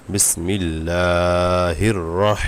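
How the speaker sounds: বিসমিল্লাহির রহ drawn out slowly in a chanting recitation tone. Shown as a demonstration. Teacher joining spelled letters into a slow Quran recitation. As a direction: recite the syllables, stretching each vowel. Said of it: "র হা জবর রহ".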